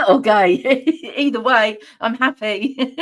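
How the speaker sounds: giggling, tickled by a typo; real amused laughter. Real, not acted. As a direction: crack up at your own remark. Amused at misread chat comment.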